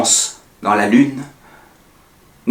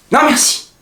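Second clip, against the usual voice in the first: much higher, ringing final refusal; high climax; 13 semitones higher than usual. Acted. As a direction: hurl it out at the peak. Peak refusal then drop.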